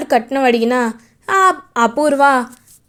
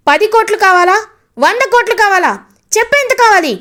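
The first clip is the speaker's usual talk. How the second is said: climbing from loud to much higher, louder shouting; building furious taunt; 4 semitones higher, 3 dB louder than usual. Acted. Brother taunting greedy in-laws about dowry, building angry shout. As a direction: throw each bigger sum at them harder. Gagan mocks in-laws' greed.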